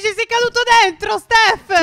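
high, loud cry of dismay; alarmed scolding. Pretend. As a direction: shout at a friend who fell in. Friend has fallen into quicksand.

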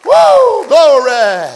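loud, high, ecstatic cry of joy; whooping with holy glory; revival fervour. Shown as a demonstration. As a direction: burst out like you just came from revival. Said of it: "act kinda like this".

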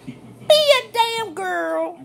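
slow, punched scolding; slow emphatic. Real, not acted. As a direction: hammer it out slowly. Ranting at Roseanne.

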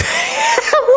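shrieking laugh; high laughing burst. Real, not acted. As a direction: crack up loudly. Laughing at trash talk.

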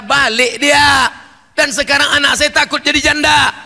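high, loud, wailing lament; wailing mother. Acted. Distraught mother wailing to the preacher, high and loud. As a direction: cry out the family's loss, rising to a wail.

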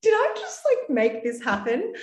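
shocked she made it happen, voice jumping up; stunned disbelief. Retold or imitated. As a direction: blurt it out, amazed it came true. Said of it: "I was like".

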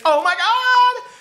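high, loud, slow shriek of delight; excited shriek. Real, not acted. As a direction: shriek with sudden joy. Sudden excited discovery.

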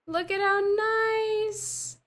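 gasp, then slow high-pitched squeal; thrilled squeal. Real, not acted. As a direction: stretch it out high in delight. Gasping over her plant.

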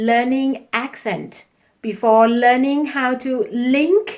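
very slow, deliberate, carefully articulated English; slow classroom dictation voice; slow English lesson read. Shown as a demonstration. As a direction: spell out each word for the learners. Teacher reads English tip slowly.

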